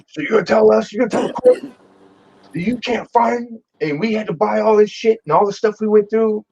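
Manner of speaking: high mock scolding judge; stern scolding. Judge scolding the clerk in court, mock stern tone. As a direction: dress down the clerk.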